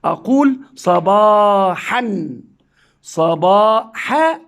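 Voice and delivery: صباحا read slowly as sabahan; slow reading with nunation. Shown as a demonstration. Teacher showing pupils the tanween on صباحا, slowly. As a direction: draw out صباحا and add a light n. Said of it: "أنطق الفتحة نونا ساكنة".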